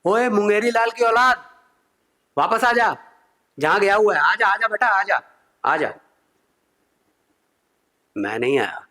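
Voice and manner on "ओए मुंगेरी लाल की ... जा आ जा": higher, carrying call to come back; calling from afar. Retold or imitated. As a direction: call him back from across the room. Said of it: "जोर से आवाज लगाई".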